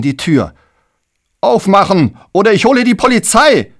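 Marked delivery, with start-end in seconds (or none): 1.4-3.7 high, loud threatening yell; furious shout. Acted. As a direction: shout through the door, hammering on it. Hämmert drohend gegen die Tür. (wütend und drohend brüllen)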